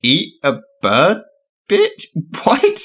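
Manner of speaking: baffled disbelief. Real, not acted. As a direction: repeat it back, baffled. Repeats baffling line in disbelief.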